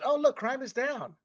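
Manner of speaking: high-pitched imitation of smug elites; mock naive announcement. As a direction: pipe up in fake pleasant surprise. Mimics elites announcing good news.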